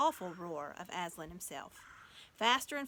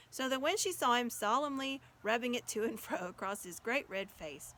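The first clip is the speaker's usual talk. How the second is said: usual speaking voice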